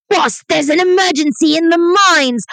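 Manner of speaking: high, slow alarmed cry of a frantic underling; a dwarf worker yelling a mine emergency; panicked messenger. Pretend. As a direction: burst in raising the alarm, drawn out. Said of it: "Do a good voice".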